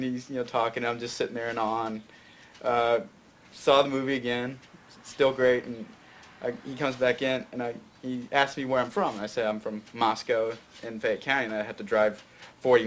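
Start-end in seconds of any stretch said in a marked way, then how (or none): none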